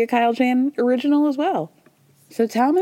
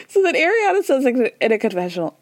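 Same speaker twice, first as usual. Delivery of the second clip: ordinary delivery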